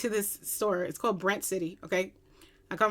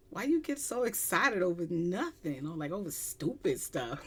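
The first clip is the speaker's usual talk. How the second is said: cool, unimpressed; 2 semitones lower, 8 dB quieter than usual. Retold or imitated. Younger sister questioning her giddy sibling, cool. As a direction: play it cool, hushed and unbothered. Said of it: "but she's cool".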